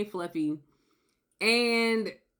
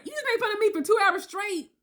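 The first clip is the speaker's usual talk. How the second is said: much higher, indignant disbelief; incredulous retort; 12 semitones higher than usual. Retold or imitated. Woman shooting back at her boyfriend in disbelief. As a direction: fire back, unable to believe it.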